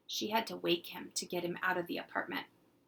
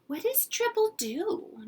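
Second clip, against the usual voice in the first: put-on much higher, slow little girl; little girl's question; 4 dB louder than usual. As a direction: ask like a curious small girl. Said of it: "Jeannie asked".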